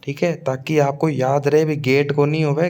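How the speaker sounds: usual speaking voice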